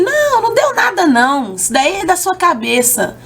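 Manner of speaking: high mock doctor brushing it off; dismissive doctor. Doctor dismissing the frightened patient, mock brushing-off voice. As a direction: mimic a doctor waving her worry away.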